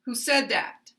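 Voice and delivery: "Who said that" all stressed, dropping at the end; stressed "Who said", falling "that"; falling question intonation. Shown as a demonstration. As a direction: ask it with a statement's fall on "that". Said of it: "intonation pattern as with a statement".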